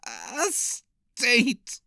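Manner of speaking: croaking voice. A narrator's reading. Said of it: "had a croaking voice".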